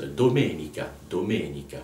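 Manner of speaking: doMEnica, Italian stress on the second syllable. Shown as a demonstration. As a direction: say domenica with weight on me. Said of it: "mais c'est domenica".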